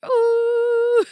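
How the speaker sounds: loud high whale squeal; whale ooh. Acted. Speaking whale, loud high ooh. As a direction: sing out a beluga call. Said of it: "I do speak whale".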